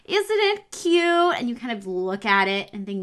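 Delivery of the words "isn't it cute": much higher and slowed, cooing like an oblivious parent; sugary mock parent coo, drawn out and high; mocking doting parent. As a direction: mimic a doting parent cooing over the crying baby. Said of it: "They think".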